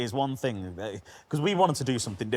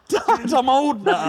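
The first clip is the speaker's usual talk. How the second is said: mock protest; 7 dB louder, much slower than usual. Mock answer to dad, high.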